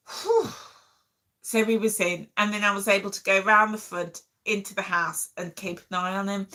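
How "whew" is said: relieved whew. Real, not acted. Relief after trapping the cat.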